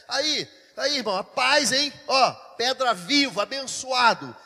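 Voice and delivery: loud, building preaching cry; roaring sermon climax; full-force preaching. Real, not acted. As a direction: preach it out to the whole church, loud and rising.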